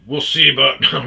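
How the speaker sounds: loud cocky challenge, laughing; trash talk. Retold or imitated. Supervisor takes on the wakeful patient, loud trash talk. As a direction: imitate a supervisor talking trash, loud and quick. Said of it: "He starts talking shit".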